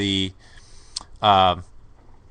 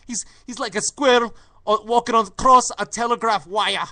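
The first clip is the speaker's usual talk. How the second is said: high, loud Geordie commentator; over-excited Geordie match-call impression; Ray Hudson; 12 semitones higher, 7 dB louder than usual. Showing the co-host that Geordie affect, high excited impression. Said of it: "he has that typical Geordie".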